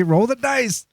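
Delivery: high-pitched gambler's cry; mock daring call. Acted thought, daring fate.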